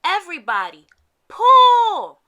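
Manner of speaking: loud high shout on pull; heave-ho command to the team; rallying shout. Acted. Santa urges the reindeer to pull, loud rallying shout.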